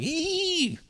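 a silly fish piping up, very high; squeaky little fish. Pretend. Little fish under the surface squealing, high and silly. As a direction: squeal it high, then drop. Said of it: "being like".

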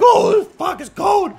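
very high, loud squawk of cold; mock freezing shout. Imitating freezing commuters at the train station, shrill mock shouting. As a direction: yelp it like someone freezing.

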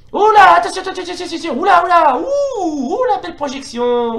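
high, whooping play reaction; loud high yelps easing into admiration; excited alarm. Real, not acted. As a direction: whoop your way through a near miss. Excited reaction to live fight.

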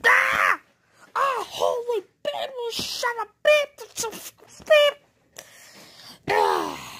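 high cartoon shriek of terror; panicked scream. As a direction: scream as if being hit. Sonic attacked, screaming.

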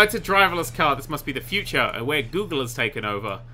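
ordinary talk, nothing marked

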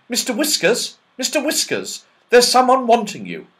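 high, loud call down a hole. Acted. Rabbit calling down his hole for the elf, loud high call. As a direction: shout it down into the burrow.

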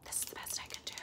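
very soft, voiceless whisper; hushed ASMR delivery. Pretend. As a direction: whisper close to the mic. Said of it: "Please do A S M R again".

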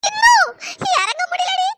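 wailing cry. Acted. Stuck girl wails she can't get down.

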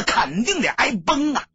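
ordinary delivery, nothing marked

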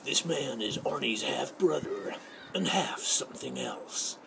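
slow, drawn-out super-macho voiceover. Acted. Mimicking macho voiceover dudes for budding voice actors, macho and slow. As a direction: play the big-voiced macho announcer, taking it slowly. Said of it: "super macho dudes with really great voices".

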